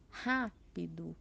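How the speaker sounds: rápido, slowly, with "rá" held long; stress on antepenultimate syllable. Shown as a demonstration. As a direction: stretch the first syllable of rápido. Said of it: "a sílaba tônica é a antepenúltima". (tônica alongada no "rá" de "rápido")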